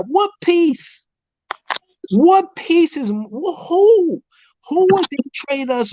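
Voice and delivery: high incredulous what piece, who?; exasperated disbelief. Real, not acted. Fan mocking trade rumors, high incredulous disbelief. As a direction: scoff at the idea in disbelief.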